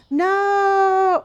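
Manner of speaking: a high, loud no after a gasp; genuinely aghast at the news; shocked no. Real, not acted. Gasps at gym news.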